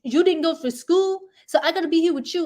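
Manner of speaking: exaggerated angry husband; mocking the husband. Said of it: "So he's like".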